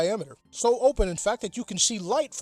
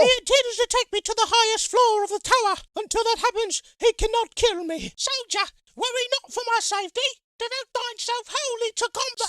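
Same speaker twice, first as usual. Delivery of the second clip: much higher, prim noble lady; high princess voice; 6 dB louder than usual. Acted. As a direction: speak like a haughty captive princess. Captive princess speaks.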